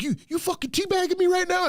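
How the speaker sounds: high-pitched mock outrage; outraged rival gamer. Killed player protesting to his killer, imitated in a high, outraged voice. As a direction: squeal it like an indignant loser. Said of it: "And I killed the dude".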